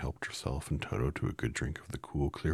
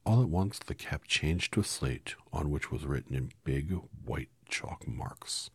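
ordinary delivery

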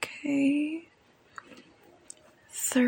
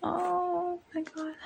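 high, hushed moan into words; quiet, overcome moan; soft moaning; 4 semitones higher, 6 dB quieter than usual. Acted. Moaning with pleasure, kept quiet.

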